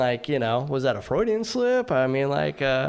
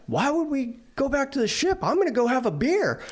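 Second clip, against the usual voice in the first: much higher, excited stranger's voice; stranger's carefree reply; 14 semitones higher than usual. Retold or imitated. Sailor imitating the stranger waving off going back to the ship, high and giddy. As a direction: toss it off high and giddy, already thinking of beer. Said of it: "He's like".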